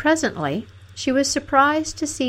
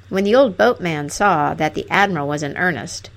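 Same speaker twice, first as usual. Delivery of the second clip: ordinary delivery, nothing marked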